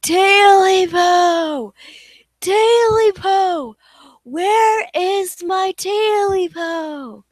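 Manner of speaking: slow rising ghostly wail. Acted. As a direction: wail it like a spirit closing in. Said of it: "only this time a little louder".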